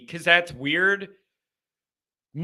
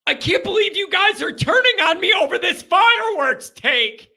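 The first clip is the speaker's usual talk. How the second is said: much higher, louder outraged yell; indignant outburst at friends; exasperated shout; 12 semitones higher, 4 dB louder than usual. Real, not acted. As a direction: shout it in mock disbelief at the room. Exasperated at friends turning on him.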